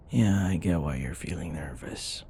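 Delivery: reassuring whisper. Acted. To the nervous partner in bed, a reassuring whisper.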